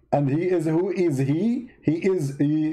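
ordinary talk, nothing marked

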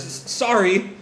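a touch of a sputter on the first sound. Acted. Nagi sputters at the laughing mother, mildly flustered. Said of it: "Nagi sort of sputters".

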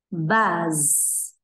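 "Buzz" ending on a clear, held z; "Buzz" giữ rõ âm z cuối; final z shown. Shown as a demonstration. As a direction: finish "Buzz" with a buzzing z. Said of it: "có âm cuối lại".

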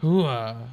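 loud gung-ho soldier. Pretend. Gung-ho battle cry in game.

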